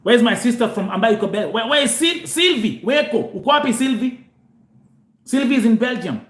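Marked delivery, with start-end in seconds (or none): 0.0-4.3 calling out. Real, not acted. Looking for Sylvie among live listeners.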